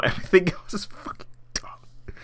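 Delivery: laughing under the breath; whispered laugh. Real, not acted. Laughing aside about the movie.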